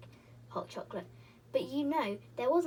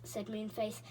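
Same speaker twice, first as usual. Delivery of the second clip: plain reading voice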